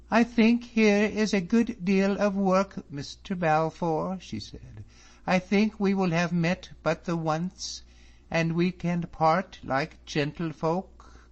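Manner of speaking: high, measured, polite dismissal; cool farewell. A narrator's reading. Miss Drummond dismissing David, high and measured. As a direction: part from him coolly.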